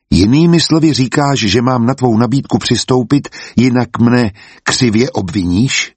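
plain reading voice

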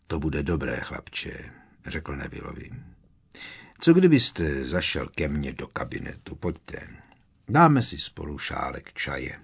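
Moody gently comforting shaken Neville. Acted. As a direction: soothe the frightened boy gently. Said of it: "zněl mnohem tišeji a laskavěji".

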